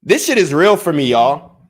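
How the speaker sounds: really mean it, all fired up; worked up. Real, not acted. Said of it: "I don't like to get worked up on stream".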